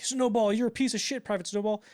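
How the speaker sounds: high, loud mock drill sergeant; Hartman. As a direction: berate a recruit, hammed up. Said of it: "Hartman's like".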